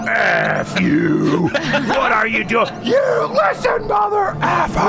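high, slow furious shout; mock screaming rant. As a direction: rage down the phone. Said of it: "screams this epic rant".

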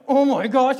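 loud, high, overwhelmed cry; panicked outburst. Retold or imitated. Husband looking out the window, acted panicked outburst. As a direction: act out losing it, suddenly loud and high. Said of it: "breaking down myself".

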